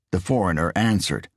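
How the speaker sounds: usual speaking voice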